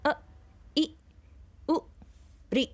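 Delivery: अ, इ, उ, ऋ each clipped very short, with pauses between; short vowels. Shown as a demonstration. Teacher showing the pupils the short vowels. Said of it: "उच्चारण करते हैं".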